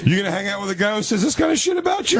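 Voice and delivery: high, hammed-up indignant whine; squeaky mock outrage; mock outraged squeal. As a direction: play up a shocked high voice.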